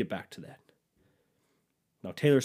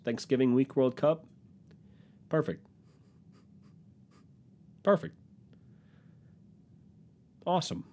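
sarcastic; 4 semitones higher than usual. Acted. Sarcastic praise, high pitch.

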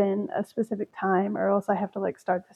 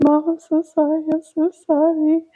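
high, pleading puppy voice, a little louder; imitating the dog; 7 semitones higher, 5 dB louder than usual. Voicing her guilty dog apologizing after puking, high pleading voice. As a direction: put words in the sad dog's mouth, pitched up. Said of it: "'cause that's how she talks to me".

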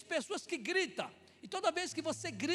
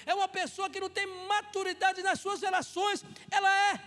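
loud, driving sermon delivery; fervent preaching; 4 semitones higher, 9 dB louder than usual. Acted. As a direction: preach it out to the whole church. Preaching at full force. (pregação fervorosa e alta)

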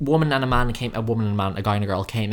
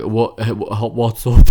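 loud, forced mock straight-man voice; straight guy impression; 2 semitones lower, 8 dB louder, slower than usual. Mocking straight men forcing their voices, straight guy impression. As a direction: put on a halting straight-bloke greeting. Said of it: "That's my straight impression".

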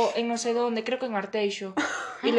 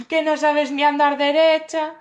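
loud, slow sneer; mocking taunt; 4 semitones higher, 6 dB louder than usual. Men jeering at tipsy Andrea, slow loud mockery. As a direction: drag out the insult loudly. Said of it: "empezaron a decirle".

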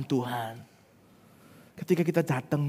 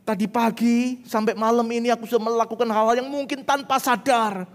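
high, pleading prayer voice; acted bedtime prayer; 9 semitones higher, 5 dB louder than usual. Believer confessing to God before sleep, high and pleading. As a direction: plead earnestly, pitched high. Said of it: "dia akan berdoa".

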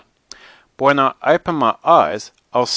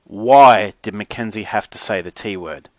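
plain reading voice

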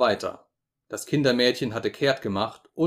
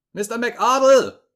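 even louder, much higher, slow yell of the name; louder desperate shout; 12 semitones higher, 9 dB louder, slower than usual. A narrator's reading. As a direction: yell again, more frantic. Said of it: "schrie ich".

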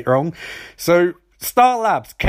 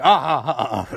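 mock villain laugh; 4 semitones lower, faster than usual.

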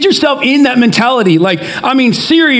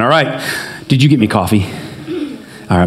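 usual speaking voice

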